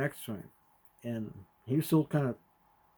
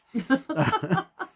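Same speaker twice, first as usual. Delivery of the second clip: high chuckling laugh in short bursts; 13 semitones higher, 4 dB louder than usual. Real, not acted. Father amused at narrating his nod for podcast, laughing.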